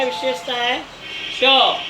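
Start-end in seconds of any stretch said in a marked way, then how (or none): 1.4-1.8 ट said alone, louder, with the tongue snapped off the roof of the mouth; emphatic retroflex sound. Shown as a demonstration. Teacher showing students the ट sound, loud crisp retroflex. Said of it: "जीभ जो है सीधे ऊपर जाती है और इस तरह से एक झटका".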